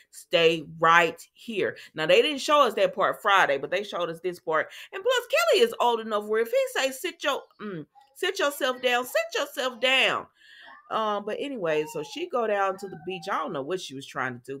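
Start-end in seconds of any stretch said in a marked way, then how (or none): 0.1-1.8 slow firm order. Retold or imitated. Retelling Finn's order. 4.9-10.2 mock scolding.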